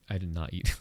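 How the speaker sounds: ordinary talk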